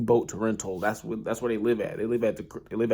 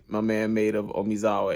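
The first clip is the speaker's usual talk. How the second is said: ordinary delivery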